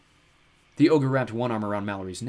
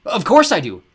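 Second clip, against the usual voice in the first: put-on boy's high yell, much louder; 10 semitones higher, 14 dB louder than usual. Jared yells back at the fake father, loud. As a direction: burst out with a shout. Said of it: "Jared yelled".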